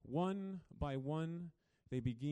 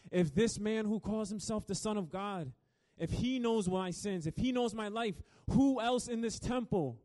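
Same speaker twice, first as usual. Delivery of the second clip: Pharisees' anxious thoughts; 8 semitones higher, 7 dB louder than usual. Acted. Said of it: "they're thinking to themselves".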